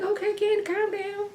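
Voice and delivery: small, high, very soft voice; hushed calming. Retold or imitated. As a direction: act out gingerly settling a worked-up friend. Retells soothing an angry Kenya.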